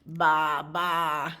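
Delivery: loud, drawn-out baa; goat bleat. Acted. Reader voices the goat, loud bleat. Voicing more goats.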